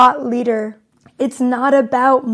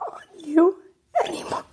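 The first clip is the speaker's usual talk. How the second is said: a breaking, whispered sob on "It is not on you anymore"; tears take the voice away on "anymore"; crying whisper; 3 semitones higher, 8 dB quieter, faster than usual. Real, not acted. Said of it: "Ugly crying".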